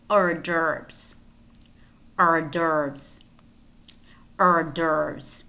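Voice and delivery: "hors d'oeuvres" as or-DERVS; silent H. Shown as a demonstration. Teacher repeating a fancy word, "hors d'oeuvres" with silent H. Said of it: "in which the H is silent".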